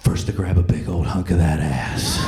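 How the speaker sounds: low, loud leering growl; lusty dad. Retold or imitated. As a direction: lay it on like a sleazy husband. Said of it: "describing things to mom".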